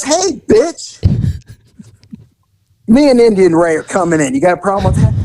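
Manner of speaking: high, rough bully voice barking at her; lightly voiced street hustler; imitating a bossy dealer; crude tough talk. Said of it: "here's what he said".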